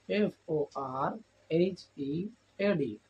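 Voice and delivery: usual speaking voice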